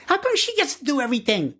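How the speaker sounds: put-on a boy protesting that his sister gets everything; angry whine. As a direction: complain fast like a jealous brother. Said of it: "an angry Haon said".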